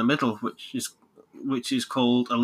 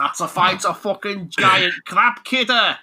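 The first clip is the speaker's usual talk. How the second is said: higher, slow, hammed-up Mancunian; Manchester accent; 6 semitones higher, 4 dB louder, slower than usual. Mocking the game hero in a fight, broad Manchester accent. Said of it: "Lancashire slash Manchester accent".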